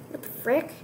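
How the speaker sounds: ordinary talk, nothing marked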